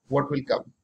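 ordinary delivery

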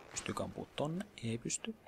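very soft self-talk; quiet private murmur. Real, not acted. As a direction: murmur the question and answer.

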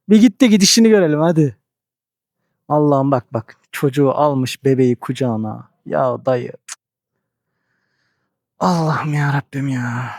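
ordinary talk, nothing marked

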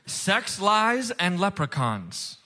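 ordinary talk

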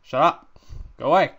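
loud annoyed yell. Real, not acted. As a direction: shout it at the pests. Yelling at mobs.

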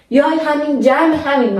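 ordinary talk, nothing marked

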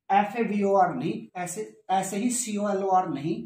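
ordinary delivery

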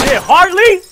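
high, loud hype shout; excited cheer. Real, not acted. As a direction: yell praise at a teammate. Cheering teammate's kill.

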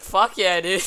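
a quoted dude-bro cheer; playful imitation of a meme line; imitating the video guy. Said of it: "It's the way he says it".

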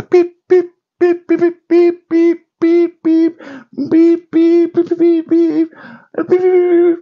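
rapid very high electronic beeps; high piercing beep string; imitated scanner beeps.

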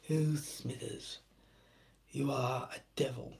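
quietly hamming up Burns. Retold or imitated. Mr Burns bit continued.

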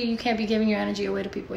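ordinary talk

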